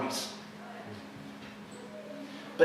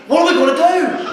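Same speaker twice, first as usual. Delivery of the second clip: loud, high mock panic; freaking out; 13 semitones higher, 10 dB louder than usual. Spouse worried about job and future, freaking out. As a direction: wail the worry in exaggerated alarm. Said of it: "he's freaking out".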